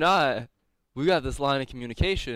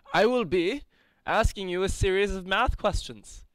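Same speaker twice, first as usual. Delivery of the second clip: pompous orator voice; 6 semitones higher than usual. Acted. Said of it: "It was my pompous orator voice".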